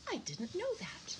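high, wondering bird voice; wide-eyed little sparrow; surprised sparrow. A narrator's reading. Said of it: "his eyes were round with surprise".

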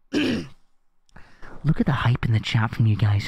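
slow hushed whisper to the chat. Real, not acted. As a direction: breathe it out, barely voiced.

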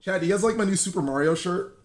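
ordinary talk

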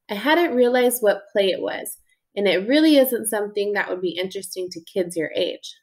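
plain reading voice

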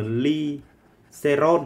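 glycerol drawn out very slowly, rising; slow dictation. Shown as a demonstration. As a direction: stretch glycerol out for the class. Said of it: "chúng ta cứ nhớ là".

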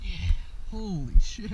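excited exclamation. Real, not acted. Angler landing a big bass, slow loud amazement. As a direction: savor it like a big catch.

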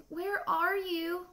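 put-on slow, high baby bat voice; squeaked. A narrator's reading. Baby bat searching for her mother, slow high squeak. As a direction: draw it out in a high little squeak. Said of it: "Stella Luna squeaked".

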